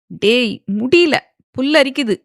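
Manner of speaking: hammed-up fake awe; sarcastic. A narrator's reading. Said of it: "சாகி நக்கலாக சொல்ல".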